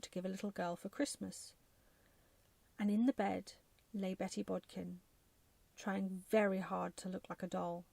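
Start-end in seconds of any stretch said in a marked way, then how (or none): none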